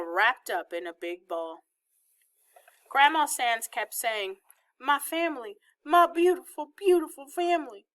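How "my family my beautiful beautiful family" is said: high, mildly tearful Southern grandmother; weeping, Southern accent. A narrator's reading. Grandmother hugging her whole family, weeping in a Southern accent. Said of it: "with that Southern-style accent and all the weeping".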